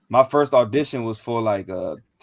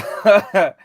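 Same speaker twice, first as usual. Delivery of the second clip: brief, higher and louder chuckle; short laugh; 7 semitones higher, 7 dB louder than usual. Real, not acted. Guest recalling his audition nerves, short amused laugh. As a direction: laugh briefly at your own story.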